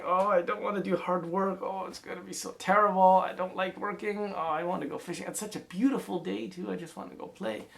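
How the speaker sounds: put-on high, whining child's voice; whiny kid. A narrator's reading. Lazy son complaining to himself, high and whiny.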